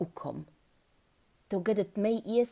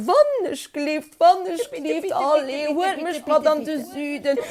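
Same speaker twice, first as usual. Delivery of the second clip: high, loud pleading; begging and wheedling like a child; whiny, insistent begging; begging tortoise; 10 semitones higher, 9 dB louder than usual. A narrator's reading. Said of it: "fir nach en Ke ze flewen an ze biedelen".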